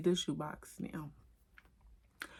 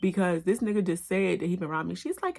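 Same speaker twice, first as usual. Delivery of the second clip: normal talking voice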